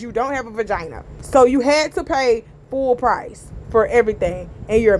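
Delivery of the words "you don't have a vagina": lightly mimicking [name], quick and softer; a quick breezy [name] line. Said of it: "In my [name] voice".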